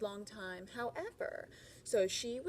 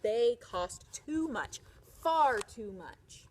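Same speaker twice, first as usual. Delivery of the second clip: slow, loud refusal; stern refusal; 7 dB louder, slower than usual. Acted. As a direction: drag out the "too much". Husband refuses to buy.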